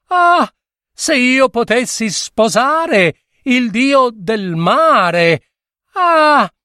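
high, sighing, lovesick gushing; mock swooning girl. A narrator's reading. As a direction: parody the dreamy girls who long for him. Mocking swooning girls.

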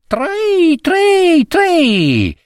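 very high chirping tri tri tri; cricket trill. Acted. Cricket answering the husband with a high trill.

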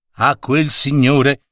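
usual speaking voice

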